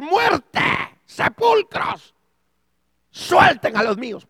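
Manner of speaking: high, drawn-out thunderous shout; imagine God ordering the graves open; voice of command. Acted. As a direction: bellow it as a divine command. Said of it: "con voz de mando. Ah, ¿qué será esa voz?".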